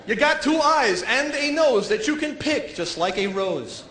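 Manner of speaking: hammed-up poem recital, lines drawn out; mock poet. Guy reading his poem at a competition, mock-poetic.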